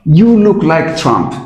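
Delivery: slow and drawn out; every word spoken slowly. Shown as a demonstration. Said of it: "slow demo".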